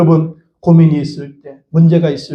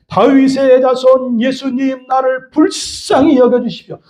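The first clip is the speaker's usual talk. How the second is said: high, drawn-out plea to Jesus; crying out; 5 semitones higher, slower than usual. Acted. Preacher voices Bartimaeus begging Jesus for mercy, crying out. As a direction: call out slowly on a high, sustained pitch. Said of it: "바디메오처럼 외쳐봅시다".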